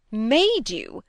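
"Made" high and loud, then dropping away; stress on Made. A narrator's reading. Preston challenges Cynthia's word, heavy stress on "Made". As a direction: hit "Made" hard as a challenge. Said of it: "laying an emphasis on the first word".

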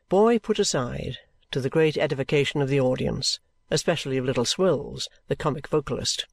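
ordinary delivery

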